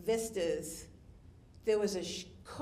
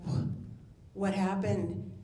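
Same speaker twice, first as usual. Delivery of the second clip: usual speaking voice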